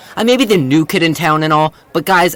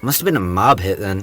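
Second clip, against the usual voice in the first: put-on low kid voice; 9 semitones lower than usual.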